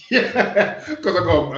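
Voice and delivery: laughing through the words. Real, not acted. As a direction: bursts out laughing, loud and high. Laughs about forgetting.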